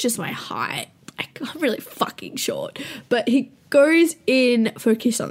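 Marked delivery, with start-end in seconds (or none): 0.0-2.8 unvoiced confiding whisper; whispered aside about her height. Real, not acted. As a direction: whisper it like a secret. Embarrassed confession aside.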